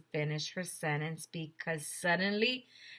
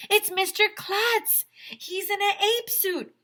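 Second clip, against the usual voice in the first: much higher, louder, slow excited cry; excited yell; 7 dB louder than usual. Acted. Andrea tells the crowd who it is, excited high yell. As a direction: announce the discovery with thrill. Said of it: "yelled Andrea".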